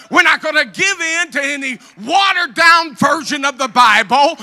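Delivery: loud, rising preacher's shout; full-force preaching. Acted. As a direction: preach it out, building to a roar. Preaching climax to congregation.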